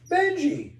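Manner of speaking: high loud call of a name; crying out. Acted. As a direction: shout for the dog in alarm. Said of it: "cried Paul".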